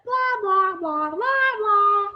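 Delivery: high, loud blah-blah phone chatter; mock phone gossip, high and loud; chattering mom. Family noise, mom on phone.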